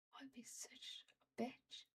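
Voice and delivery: hissed whisper at the program; cursing whisper. Real, not acted. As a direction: swear softly under your breath. Cursing program under breath.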